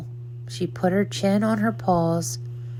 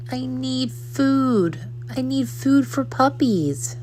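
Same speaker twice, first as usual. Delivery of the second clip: pleading puppy; 6 semitones higher than usual. A narrator's reading. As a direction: whine it out like a hungry puppy. Puppy begs on the floor.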